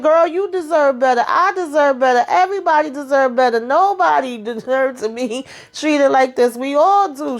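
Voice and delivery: high, fired-up imitation; high sassy rallying speech; mock pep talk. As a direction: preach it to her up high. Danny preaching to Mindy, quoted.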